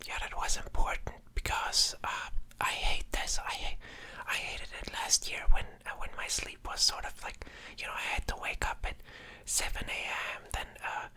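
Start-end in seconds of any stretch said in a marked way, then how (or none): none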